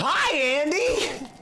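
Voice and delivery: high, slow sing-song hello; drawn-out falsetto hi; mock greeting. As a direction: greet him like a cheery cartoon. Greeting game character in put-on voice.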